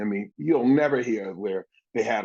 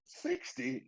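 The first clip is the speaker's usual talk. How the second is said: breathy stunned repeat, much higher; mock disbelief; 12 dB quieter than usual. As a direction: echo the number in disbelief. Shocked retort at locker.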